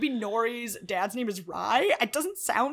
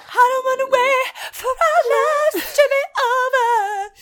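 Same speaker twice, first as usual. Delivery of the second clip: much higher, slow melodrama; dramatic teen whine; 12 semitones higher, 6 dB louder, slower than usual. Retold or imitated. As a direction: overwrought teen soap line. Quoting teen drama line.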